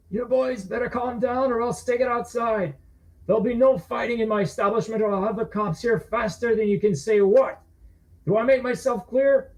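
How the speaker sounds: high, firm bartender warning; stern barman. Acted. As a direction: lay down the law. Barman warns the bullies.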